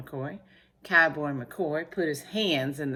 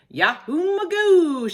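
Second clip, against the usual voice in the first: high, loud, drawn-out whoop; long cowboy yell; excited shout; 11 dB louder, slower than usual. A narrator's reading. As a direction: holler it like an exultant cowboy. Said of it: "shouted Cowboy McCoy".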